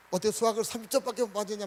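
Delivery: exasperated parent, acted out; scolding mother. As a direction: imitate a mother scolding over a test score. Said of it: "막 야단을 쳤어요".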